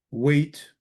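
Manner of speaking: "wait" said as one separate syllable, rising; pronunciation model. Shown as a demonstration. Teacher recites Syriac conjugation to class, "wait" separate and rising. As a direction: model "wait" with a lifted ending. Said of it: "the way you pronounce it is".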